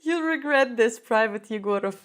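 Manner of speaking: high voice, breaking up with laughter; laughing. Real, not acted. Teacher translating the film line, high and laughing.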